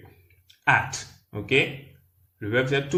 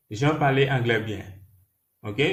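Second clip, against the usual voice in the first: ordinary talk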